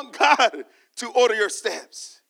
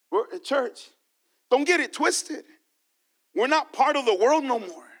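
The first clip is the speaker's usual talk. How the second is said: impassioned preacher calling out; preaching; 4 semitones higher, 3 dB louder than usual. Acted. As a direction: preach it to the church with fire. Preacher addressing congregation.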